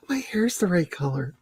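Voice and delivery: high, soft mock delight; mock gasp. Admiring her own purple hair, high mock delight. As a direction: play it as a delighted little gasp.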